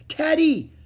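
high, loud call of a name; excited high yell; crying out. A narrator's reading. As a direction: cry out for a lost dog. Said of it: "cried Annie".

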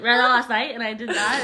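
slurred, laughing mumble; mumbled speech. Shown as a demonstration. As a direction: blur the words together. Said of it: "But it comes across like".

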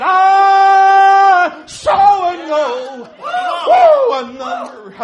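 very slow, loud, high shout; full-force preaching. Acted. Preacher to congregation, whooping over the great multitude. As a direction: stretch each word out in a whoop.

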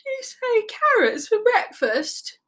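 high, slow sleepy sloth; drowsy voice just woken up; tired. A narrator's reading. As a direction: drag it out wearily. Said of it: "asked Sloth in a tired voice".

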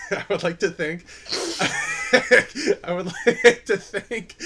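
high, slow words through laughter; can't stop laughing mid-sentence. Real, not acted.

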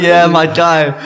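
loud, high, thrilled thanks; overjoyed. Retold or imitated. Thanking the technician who saved the video, loud and elated. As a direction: shout your thanks, elated.

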